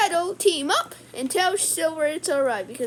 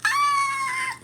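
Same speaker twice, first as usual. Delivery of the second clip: a squeaky, sharp cry that jumps far up; sudden high yell. Acted. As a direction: yelp like a cartoon shock. Playful yell mid-story.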